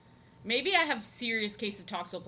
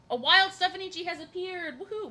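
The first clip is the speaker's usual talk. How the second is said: higher, louder playful announcement; game announcer bit; 10 semitones higher, 8 dB louder than usual. Real, not acted. Streamer greeting a viewer in a mock game announcement.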